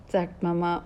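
ordinary delivery

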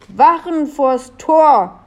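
slow, high, loud order; gasped command. A narrator's reading. Sneezing Leo orders his guards, gasping it out. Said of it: "keuchte Leo".

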